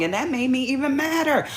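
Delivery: normal talking voice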